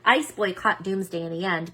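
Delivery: ordinary delivery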